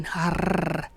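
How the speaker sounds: "harr" ending in a long trill; playful sustained tongue roll; rolled R noise. Shown as a demonstration. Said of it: "decide to start going".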